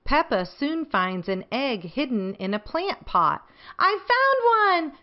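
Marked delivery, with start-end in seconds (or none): 3.8-5.0 high triumphant squeal of a find; excited shout. A narrator's reading. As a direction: shout it out like a thrilled little girl. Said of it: "shouts Peppa".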